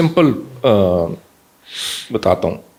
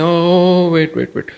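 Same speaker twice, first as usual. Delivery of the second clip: put-on higher-pitched parent hurrying to hush a child; flustered parent; 6 semitones higher, slower than usual. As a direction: act out a parent's drawn-out "oh", then placating. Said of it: "ماں باپ کہتے ہیں". (گھبرائے ہوئے والدین کی نقل، اونچی آواز میں)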